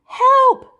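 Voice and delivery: high, loud call; cry for help. Acted. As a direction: shout for help from afar. Someone calling for help.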